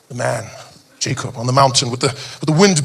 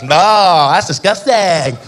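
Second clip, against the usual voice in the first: put-on disgusted girl; much slower than usual. Beach girl refusing the offer, slow and disgusted. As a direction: recoil like a grossed-out girl. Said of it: "She's like".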